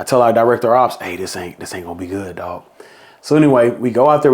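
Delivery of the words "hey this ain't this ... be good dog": low, much softer muttered warning; quiet aside. Retold or imitated. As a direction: lean in and say it under your breath. Aside to director of ops.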